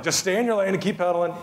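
mock a tough cop giving orders; faux tough guy. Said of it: "in this faux tough guy voice".

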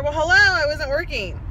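loud, high sarcastic well hello; angry sarcasm. Real, not acted. Mom ranting to viewers about bank fees, real angry sarcasm. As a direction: throw it back at the bank. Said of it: "I'm so furious".

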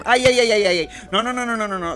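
high squealing protests; playful panic. Acted. Otra vez rodeado. (chillidos de pánico fingido)

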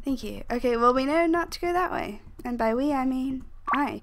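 normal talking voice

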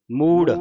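loud ਮੂੜ with a heavy, aspirated ੜ; heavy final sound. Shown as a demonstration. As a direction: bear down on the ੜ of ਮੂੜ. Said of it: "ਇਸ ਨੂੰ ਭਾਰਾ ਉਚਾਰਨ ਕਰਨਾ". (ਮੂੜ ਭਾਰਾ ਉਚਾਰਨ)

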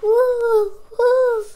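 high, loud hoots; owl hooting. Acted. Voicing the owl for young listeners, high hooting. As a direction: hoot it out like an owl. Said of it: "asked the owl".

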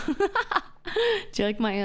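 high-pitched laughing start to a question. Real, not acted. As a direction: break into laughter as you ask.

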